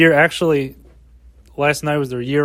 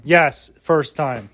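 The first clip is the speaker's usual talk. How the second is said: ordinary talk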